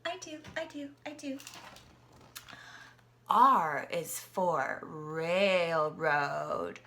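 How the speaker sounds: ordinary delivery, nothing marked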